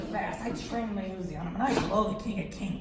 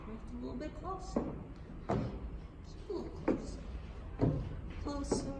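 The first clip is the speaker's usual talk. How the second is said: hushed creeping suspense; 4 semitones higher, 7 dB quieter, faster than usual. Retold or imitated. Comic sneaking closer to God, hushed suspense.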